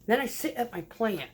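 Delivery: ordinary talk, nothing marked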